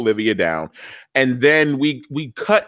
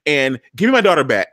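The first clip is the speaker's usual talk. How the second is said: normal talking voice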